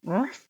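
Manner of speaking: soft half-hearted bark; a quiet, failed little ruff; weak dog ruff. Shown as a demonstration. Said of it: "That wasn't good".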